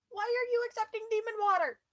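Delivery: put-on panicked old priest, loud and high; frantic shouting priest; freaking out. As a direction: shriek the accusation in alarm. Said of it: "Just freaking out".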